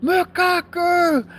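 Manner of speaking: high, drawn-out call of a name; retold calling scream. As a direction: call out to a far-off friend, stretching it out. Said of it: "I just screamed".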